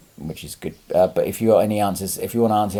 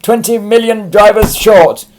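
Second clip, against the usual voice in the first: mock news headline; 11 semitones higher, 12 dB louder, slower than usual. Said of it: "you get all this".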